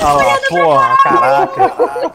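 high, loud laughing exclamation. Real, not acted. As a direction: burst out laughing in disbelief. Cracking up at the joke.

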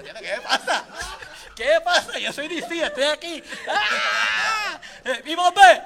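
agudo y fuerte, riéndose; euforia imitada. Retold or imitated. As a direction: gritando emocionado sin querer. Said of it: "y yo". (fan de DC que se deja llevar, gritando entre risas)

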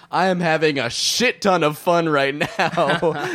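high, drawn-out mock proclamation; slow, high-pitched crowing, played for laughs; retold gleeful announcement. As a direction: imitate a manic stranger announcing himself, slowly. Acting out train man's gleeful announcement.

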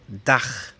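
Dach ending in a rough ch like Spanish j; Dach, ch as in Juan; German ach sound. Shown as a demonstration. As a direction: scrape the ch of Dach in the throat. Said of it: "el mismo que tiene la J en español".

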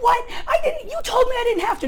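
pitched far up, fast and cracking; a kid yelling back, half laughing; indignant yell. Retold or imitated. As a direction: protest in outraged near-tears. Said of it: "And I yelled".

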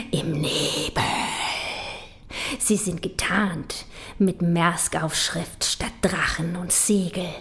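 whispered scheming; conspiratorial whisper. Acted. Poet whispering the Danish takeover plot to the audience. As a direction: hiss the plan softly, unvoiced.

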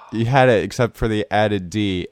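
ordinary delivery

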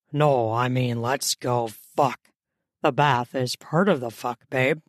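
low-pitched man's voice; male lover. Acted. Man reassuring his impatient lover, low male voice. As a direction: play the man, pitched well down.

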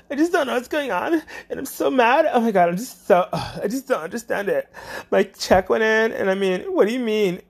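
high, frantic, upset caller; mock a hysterical customer; high and frantic, imitating a caller upset about her check; panicked caller. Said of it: "I got people out here like".

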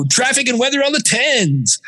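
high, punchy traffic-update announcer parody; radio DJ voice. Retold or imitated. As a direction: send up a slick radio jock. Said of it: "we weren't all talking like this".

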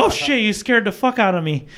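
mildly acted fright; startled. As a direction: react as if just jumped. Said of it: "fucking startled".

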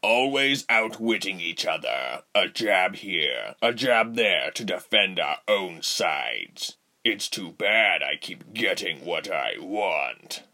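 smug villain. A narrator's reading. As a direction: say it slow and smug. Rubens voiced.

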